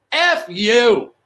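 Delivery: re-enacted shout; mock scream. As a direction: yell it across the stage, playfully held back. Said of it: "heard me screaming at her".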